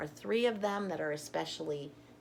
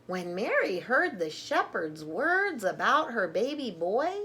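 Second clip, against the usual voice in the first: lively, louder storybook reading; reading to children; 5 dB louder, slower than usual. Acted. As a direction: read it out like a picture book for little ones. Reading alphabet book aloud.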